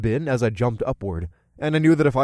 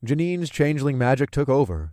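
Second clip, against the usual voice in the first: plain reading voice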